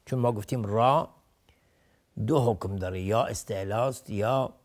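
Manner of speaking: ordinary talk, nothing marked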